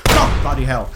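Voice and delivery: jolted by a blast; startled oath. Acted.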